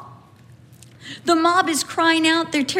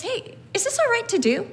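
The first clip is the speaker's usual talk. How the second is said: calm polite question; 7 semitones higher, faster than usual. Retold or imitated. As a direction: ask gently, as a calm suggestion. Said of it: "No, he just says".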